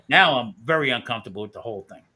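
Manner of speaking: ordinary talk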